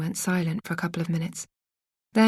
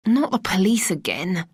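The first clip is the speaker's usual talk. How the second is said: angry. Acted. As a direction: grumble it under the breath, fed up. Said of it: "He sounded angry".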